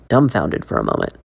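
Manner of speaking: plain reading voice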